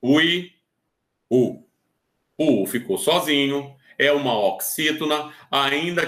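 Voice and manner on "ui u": teacher sounds out "ui" and "u" one by one; syllables split apart. Shown as a demonstration. Teacher splits tuiuiu for students, syllable by syllable. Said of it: "eu separo em tui".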